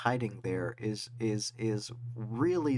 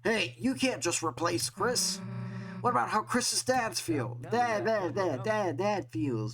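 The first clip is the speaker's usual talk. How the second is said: indignant character voice; 6 semitones higher than usual. Acted. Voicing a protesting character.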